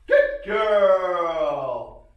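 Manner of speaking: loud, high excited praise; praise shout. Real, not acted. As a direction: cheer the dog on loudly. Praising dog in training.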